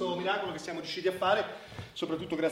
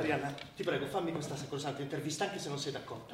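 soft, lower plea; hushed, lower entreaty; quiet pleading; 5 semitones lower, 9 dB quieter than usual. Acted. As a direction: beg her gently, almost under your breath. Doctor begging journalist for interview.

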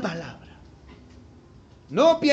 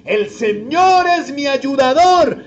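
loud, slow declaration of faith; confident declaration; 3 semitones higher, 8 dB louder, slower than usual. Shown as a demonstration. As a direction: proclaim it boldly, unafraid. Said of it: "¿y qué es lo que podemos decir?". (proclamación fuerte y confiada)

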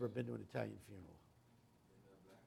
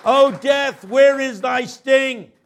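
higher, louder, slow thundering sermon; preaching at full force; 10 semitones higher, 18 dB louder, much slower than usual. Acted. Preacher thundering Paul's words to the congregation, full force. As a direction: roar the scripture at the congregation.

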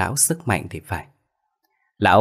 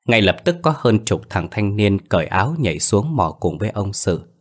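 ordinary delivery

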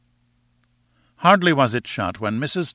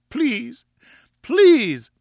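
very high begging, growing louder; desperate pleading; 13 semitones higher, 5 dB louder than usual. A narrator's reading. Wife begging husband to lift her down, desperate pleading. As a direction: plead high, second word cried out.

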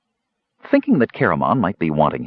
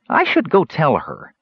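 thoughtful kender; 7 semitones higher than usual. A narrator's reading. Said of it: "said the thoughtful kender".

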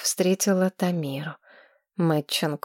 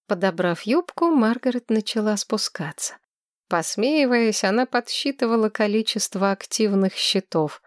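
plain reading voice

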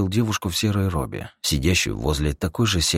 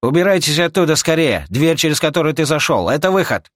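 urgent shout; 12 semitones higher, 6 dB louder than usual. Acted. Old man yelling warning.

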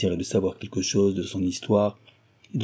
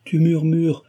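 higher, insistent reproach; exclaiming; 7 semitones higher, 4 dB louder than usual. A narrator's reading. He reminds Cécile she still has innocence, exclaiming. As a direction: press the rebuke in a raised voice. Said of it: "s'écria-t-il".

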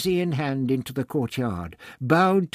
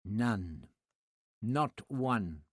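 sneering; 7 dB quieter, much slower than usual. Acted. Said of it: "Tlatoxel sneered".

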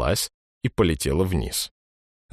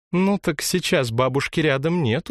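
put-on girl's voice, higher and light; smiling girl; 9 semitones higher than usual. A narrator's reading. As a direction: say it with a smile. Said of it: "улыбнулась девушка". (голос девочки с улыбкой)

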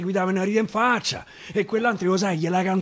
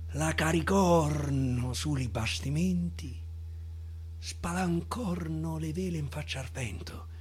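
softer and softer whisper to the end; whispered, dying close; fading whisper; 7 semitones lower, 11 dB quieter than usual. Acted. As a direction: let the story slip away in a hush. Hushed ending of the tale.

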